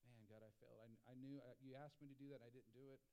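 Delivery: low, soft, defeated admission; guilty confession. Pretend. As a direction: mumble it like you've let God down. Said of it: "Anyone else been there?".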